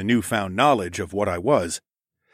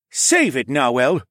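loud, higher, cutting rebuke; angry hiss; 4 semitones higher, 5 dB louder, slower than usual. A narrator's reading. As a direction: cut off the objector angrily. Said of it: "Tecumseh hissed".

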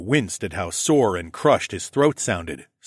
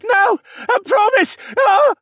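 very high, loud, desperate shout; panicked plea; 8 dB louder than usual. A narrator's reading. Victim begging an attacker, a panicked high shout. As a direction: plead in terror, ending in a scream.

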